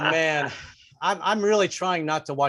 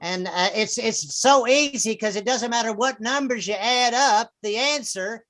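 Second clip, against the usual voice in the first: radio preacher announcing lineup; 2 semitones higher than usual. Acted. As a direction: imitate a folksy evangelist radio host. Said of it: "they give you the weekly lineup".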